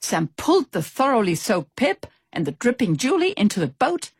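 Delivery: usual speaking voice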